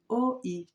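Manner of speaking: "O, I" read as two separate letters. Acted. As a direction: sound out "O, I" apart, like a struggling reader. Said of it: "Ele vai ler pra mim".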